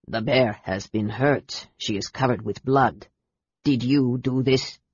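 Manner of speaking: put-on deep, low, rumbling accented chieftain; rumbling mountain spirit. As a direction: rumble like an avalanche. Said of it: "His voice rumbled like an avalanche".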